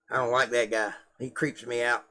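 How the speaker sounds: ordinary talk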